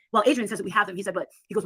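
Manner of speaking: ordinary talk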